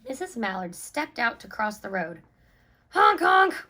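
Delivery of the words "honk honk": loud high car-horn honks. A narrator's reading. Narrator imitating speeding cars honking loudly. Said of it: "went the horns on the speeding cars".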